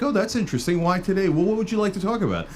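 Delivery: lifted, sing-song fake politeness; mock innocent interest. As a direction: play dumb and sweetly curious.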